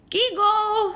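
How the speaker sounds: put-on high, loud squealing toddler; little sister squealing his name. A narrator's reading. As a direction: squeal like a two-year-old. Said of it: "squealed a loud voice".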